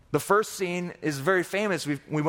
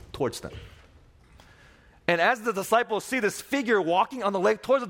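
normal talking voice

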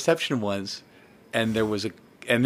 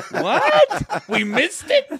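mock shocked; 11 semitones higher, much slower than usual.

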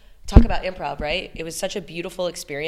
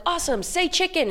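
excited praise; 12 semitones higher, 3 dB louder than usual. Pretend. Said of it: "we're like".

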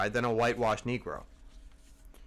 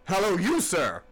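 louder hail, sharp call; cried out; 3 semitones higher, 3 dB louder than usual. A narrator's reading. Captain hailing Queequeg across the deck, loud call. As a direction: bark a hail at him. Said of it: "cried the captain".

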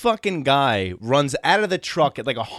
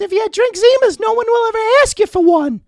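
much higher and much louder, manic squeal; John the wired boss; 7 dB louder than usual. Retold or imitated. Coked-up landscaping boss greeting the twelve-year-old, shrieking high and loud. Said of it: "He goes".

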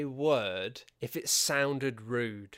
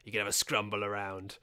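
put-on Cockney accent; 2 semitones lower than usual. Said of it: "if you do that Cockney accent".

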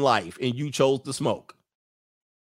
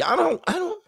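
mock squeaky refusal. Mock refusal high shout.

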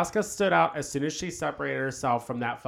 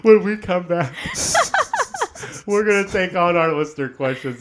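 host outro; 5 semitones higher, 8 dB louder than usual. Acted.

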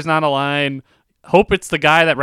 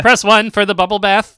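louder, higher phone menu voice; automated phone menu; 6 semitones higher, 7 dB louder than usual. Pretend. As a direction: announce the bubble bath option. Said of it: "when you call in and you have to pick a menu".

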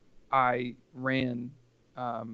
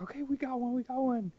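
high imitated eager report; thrilled to report a finisher; excited; 12 semitones higher than usual. Lady telling the radio a runner arrived, high excited voice. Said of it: "She was so excited".

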